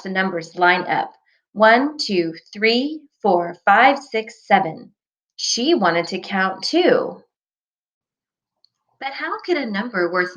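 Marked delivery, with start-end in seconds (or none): none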